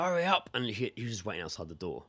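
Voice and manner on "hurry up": impatient call. Retold or imitated. Acts ex-boyfriend hurrying him from outside.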